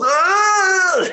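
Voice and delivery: very high, loud mock crowd squeal; crowd's ecstatic squeal. Retelling the crowd's reaction to Punk, very high ecstatic squeal. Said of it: "they collectively have an orgasm".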